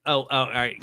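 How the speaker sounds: ordinary talk, nothing marked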